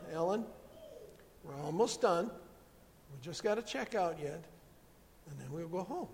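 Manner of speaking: hushed, soothing reassurance; soft, gentle voice. Retold or imitated. Mom at the candy aisle calming herself, soft and gentle. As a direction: soften right down and coax patiently. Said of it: "in a soft, gentle voice".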